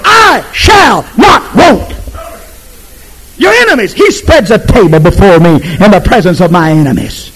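roaring pulpit proclamation; full-force preaching. Acted. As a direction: shout the psalm in triumph.

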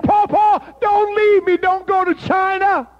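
put-on high, loud pleading child's voice, slowing at the end; pleading cry. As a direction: beg him not to go. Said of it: "And she said".

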